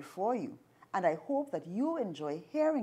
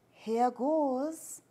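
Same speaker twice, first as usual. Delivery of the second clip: sighed; 5 semitones higher than usual. Acted. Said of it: "sighed Anansi".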